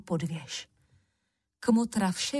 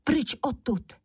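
higher-pitched warning call; urgent cry; 5 semitones higher than usual. A narrator's reading. Hero warns the wild women to flee, urgent cry. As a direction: cry a warning, pitch raised, volume held back. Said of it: "vykřikl na divoženky".

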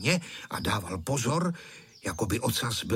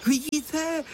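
high-pitched, drawn-out thrilled exclamation; excited cry; much slower than usual. A narrator's reading. Boy pointing out the kite to friends, excited cry. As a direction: call out in wonder, pitched well up. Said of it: "vykřikl vzrušeně Honzík".

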